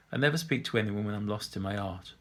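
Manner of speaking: plain reading voice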